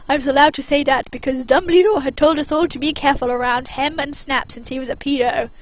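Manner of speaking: plain reading voice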